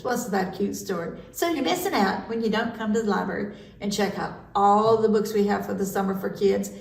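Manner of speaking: ordinary delivery, nothing marked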